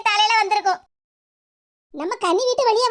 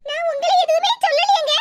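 higher, pleading and alarmed; anxious pleading; 7 semitones higher than usual. Acted. Wife anxiously pleading with her crying husband. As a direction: beg him to say what's wrong.

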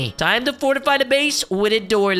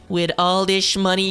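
ordinary talk

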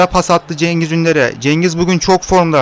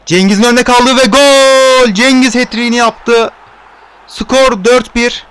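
high, loud goal scream, slowing on the score; screaming football commentator; 4 semitones higher, 4 dB louder than usual. Real, not acted. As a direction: shout the hat-trick goal. Hat-trick goal call.

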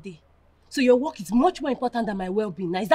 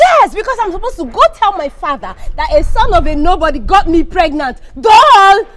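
high, loud furious outburst; angry; 7 semitones higher, 12 dB louder than usual. Acted. Woman dreading telling her father, shouting at her boyfriend. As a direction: shout it with scornful anger. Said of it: "You stop sounding like this".

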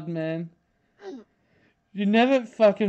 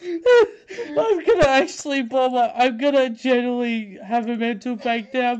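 laughing; 6 semitones higher, 4 dB louder than usual. Real, not acted. Laughing at own meme.

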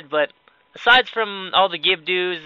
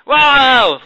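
loud, high cry of surprise; startled yell; 7 semitones higher, 9 dB louder than usual. Real, not acted. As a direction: burst out, caught off guard. Startled during play.

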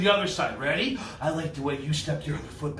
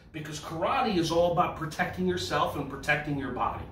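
normal talking voice

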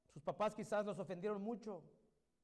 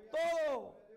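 emphatic shout; 6 semitones higher, 6 dB louder than usual. Real, not acted. Emphatic climax word.

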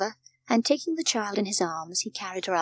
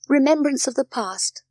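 ordinary delivery

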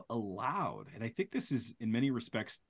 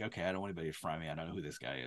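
quick, hushed mock worry, much softer; mock nervous mumble; 7 semitones lower, 10 dB quieter, much faster than usual. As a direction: rattle it off under your breath as if scared of being caught. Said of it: "You're like".

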